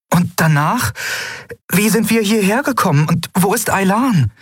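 deutlich höhere Frauenstimme, besorgt fragend; Endriel, drängend. A narrator's reading. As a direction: hastig nachfragen, hoch. Endriel voiced, urgent questions. (Endriel fragt besorgt nach Aylan, hohe Stimme)

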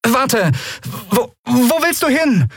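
high, stammering call after someone; alarmed call. A narrator's reading. Endriel calls after departing Kero, alarmed. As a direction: call out to stop them, pitch high.

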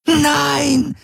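very high, drawn-out scream; desperate scream. Acted. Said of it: "schrie Ylana unaufhörlich". (verzweifelter, hoher Schrei)

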